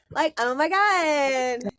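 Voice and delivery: put-on a light Valley girl take on "oh my God". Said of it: "this [name] song called Valley Girl".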